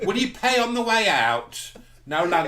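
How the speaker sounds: high, loud, slow madam voice; seedy receptionist. Retold or imitated. Receptionist asking patient to pay, hammed-up high madam. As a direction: drag out the payment question.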